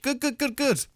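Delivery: a light hint of a proud dad. Lightly imitating a dad praising the band. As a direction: say it like an approving dad, mildly. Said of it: "like a dad again".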